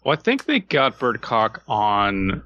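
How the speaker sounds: ordinary talk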